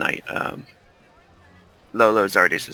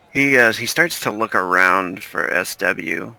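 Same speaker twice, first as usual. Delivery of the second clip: ordinary delivery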